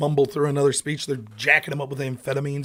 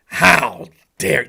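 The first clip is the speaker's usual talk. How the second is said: mock indignation; 9 dB louder than usual. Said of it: "when you get this reaction".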